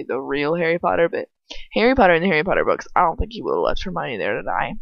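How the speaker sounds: ordinary talk, nothing marked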